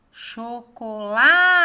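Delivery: "Chocolate" called with a long, loud LA; stress on LA. Shown as a demonstration. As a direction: stretch and push the LA in "Chocolate". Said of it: "Lá é a sílaba tônica". (chamando "Chocolate", com o LA forte e alongado)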